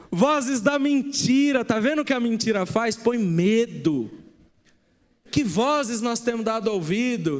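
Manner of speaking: preaching. Acted. Pastor warning the church about lying voices, preaching. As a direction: drive the point home to the congregation.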